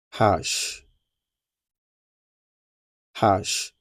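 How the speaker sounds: Hash said slowly as the French letter name ash. Shown as a demonstration. Teacher modelling the French letter H. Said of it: "It is pronounced as ash".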